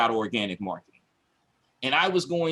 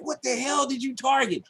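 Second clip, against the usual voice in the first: exasperated yell; 11 semitones higher than usual. Retold or imitated. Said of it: "He's like".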